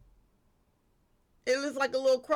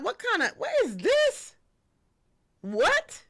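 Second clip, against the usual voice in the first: rising, higher and higher in disbelief; mock shock; 10 semitones higher, 5 dB louder, slower than usual. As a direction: imitate someone stunned by a strange bug. Acting shocked at Florida roaches.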